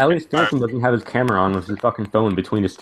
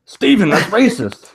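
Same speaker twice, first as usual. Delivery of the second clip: high, slow, loud reprimand; scolding mock reprimand; 10 semitones higher, 6 dB louder, slower than usual. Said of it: "Me reprimanding you".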